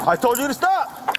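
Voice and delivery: very high, slow, furious yell; yelled order. Real, not acted. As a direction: yell it with full force. Officer yells at resisting suspect.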